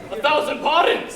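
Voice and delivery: squealed. Acted. Pig one squeals an apology to his brother. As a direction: squeak out a frantic sorry. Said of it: "squealed one".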